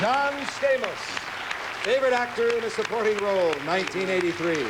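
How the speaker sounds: award announcement. Real, not acted. Host presents actor with his award, high ringing announcement. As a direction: call out the winner to applause.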